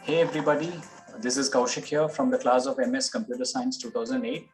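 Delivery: ordinary delivery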